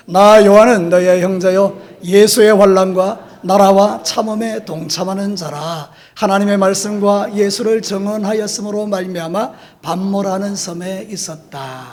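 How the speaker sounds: slow, measured verse reading aloud; scripture reading. Real, not acted. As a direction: read the verse out slowly for all to follow. Reads the verse aloud to congregation. (천천히 또박또박 성경 봉독)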